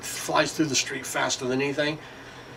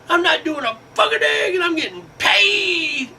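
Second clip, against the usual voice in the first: very high, hammed-up bragging voice; mock idle worker; 14 semitones higher than usual. Idle worker boasting he gets paid, very high mocking voice. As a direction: brag way up high, almost squealing. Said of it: "I heard it forever".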